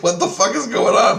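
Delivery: high, loud baffled exclamation; retold bewilderment. Teller baffled beside the bleeding man, bewildered. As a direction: relive the confusion, voice jumping up.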